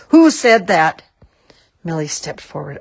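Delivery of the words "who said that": loud, raised demand; thundering. A narrator's reading. Mister Macy demands to know who spoke, thundering. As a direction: boom it out like an outraged boss. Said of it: "thundered Mister Macy".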